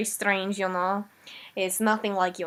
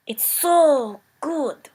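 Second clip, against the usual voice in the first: put-on slow, drawn-out, louder rapture in the Eastern European accent; savouring; 5 semitones higher, 5 dB louder, much slower than usual. Olga raves about the taste of yak, slow and rapturous in an Eastern European accent. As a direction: savour every word like the best meal ever.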